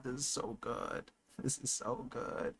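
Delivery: barely voiced, overwhelmed; awed hush. Real, not acted. Fan overwhelmed by the episode, hushed awe.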